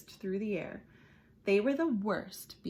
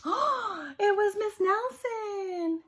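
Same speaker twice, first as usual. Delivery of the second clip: surprised joy; 13 semitones higher, 6 dB louder, slower than usual. Acted. Joyful surprise at return.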